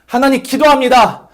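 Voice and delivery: high, loud crying-out to God; Elijah praying. Acted. Elijah under the tree crying out to God, anguished. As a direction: call up to heaven in anguish. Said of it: "엘리야가 말하는 거죠".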